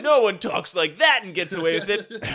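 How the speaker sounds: loud, high mock-heroic protest; hammed-up hero's outrage; defiant, bullheaded. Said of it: "as fucking defiant and bullheaded".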